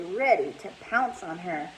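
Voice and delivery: ordinary delivery, nothing marked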